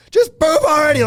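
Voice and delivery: high, loud shout of an exasperated parent; hammed-up yell at a stalling child; frustrated shout. Parent fed up with toilet trips, mock frustrated shout. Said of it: "getting so frustrated that we're like".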